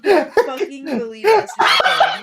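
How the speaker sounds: loud, laughing in disbelief; cracking up at the dice result. Real, not acted. Player reacts to dice outcome.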